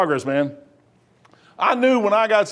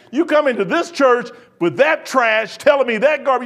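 retold angry yelling; 4 semitones higher than usual. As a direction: re-enact throwing someone out of church. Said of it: "They heard me yelling back there. I mean, I was mad".

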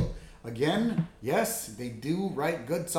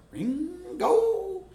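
high, drawn-out puzzled "[name]"; baffled old boss asking; puzzled Burns. Retold or imitated.

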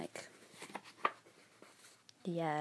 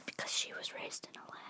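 fast whisper, much softer; whispered punchline about Luna being raised in a lab; whispered aside; 12 dB quieter, faster than usual. Real, not acted. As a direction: rush it out under the breath.

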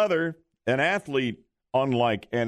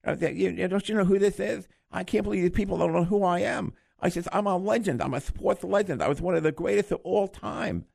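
put-on higher little teeny voice on a great big guy; Mike Tyson impression; 4 semitones higher than usual. Host imitating the famous boxer, mock little teeny voice. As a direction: boxer complaining nobody knows him, in a little voice. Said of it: "he has this little teeny voice".

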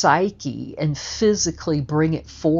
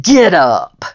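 louder, barked order; loud command; 9 dB louder than usual. Retold or imitated. Telling the beaten man to get up, loud command. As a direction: order him up off the floor. Commanding opponent after the win.

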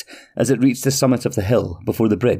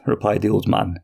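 plain reading voice

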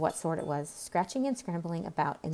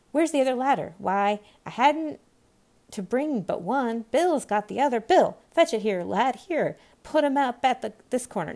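raised, bustling crew voices; busy workmen calling; 3 semitones higher, 6 dB louder than usual. Acted. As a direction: call orders across a noisy crowd of workers. Voices of workers calling orders.

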